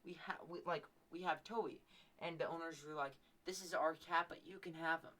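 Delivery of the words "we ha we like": ordinary delivery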